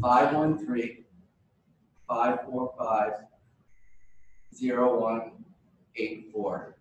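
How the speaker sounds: slowly, each digit spaced out; slow and deliberate. Real, not acted. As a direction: read the number out slowly and clearly. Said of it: "Say it again, sorry".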